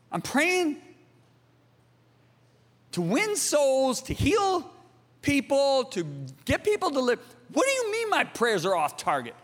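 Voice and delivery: high, incredulous objection with halting pauses; indignant protest. Retold or imitated. Protesting to God, retold.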